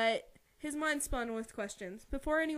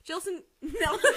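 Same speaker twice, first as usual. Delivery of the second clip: high, giggling over the mispronounced name; laughing at slip; 8 semitones higher, 7 dB louder than usual. Real, not acted. Reader laughing at their own slip on the name. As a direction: crack up over the tongue slip.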